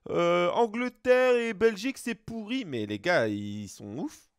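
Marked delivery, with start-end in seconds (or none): 0.1-2.6 higher, drawn-out mock whine of a hater; mocking online complainers. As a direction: imitate complainers online, dragging it out. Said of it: "ils ont dit".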